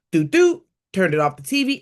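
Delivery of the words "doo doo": high sung little "doo doo" jingle; imitated TV chime. Telling viewers she rushed from the TV, high playful chime. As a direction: chirp it like a power-off tune, high and bright. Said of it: "I was like".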